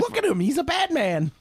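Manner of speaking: very high squealing nerd voice; nerdy screeching. Acted. Drowning out the case for character motivation, nerdy screeching. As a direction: screech over the objection like a fanboy. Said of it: "nerdy, like, screeching".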